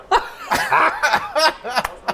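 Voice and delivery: long high-pitched burst of laughter; helpless high laughing; real laugh. Real, not acted. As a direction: laugh hard at an absurd reveal. Laughing at the towel story.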